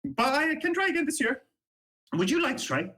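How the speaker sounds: put-on high, eager young halfling guard boasting. Young halfling guard to the player, eager and high. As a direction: pitch it up like a keen young guard inviting a friend.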